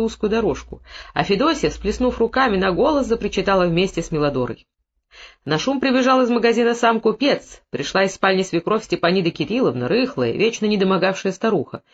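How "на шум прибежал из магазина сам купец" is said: plain reading voice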